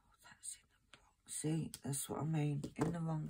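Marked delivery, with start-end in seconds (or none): 0.1-1.1 breathy whisper to herself; whispered mutter. Real, not acted. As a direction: mutter it under your breath. Muttering to herself, wrong place.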